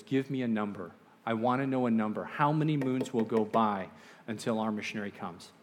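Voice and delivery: ordinary delivery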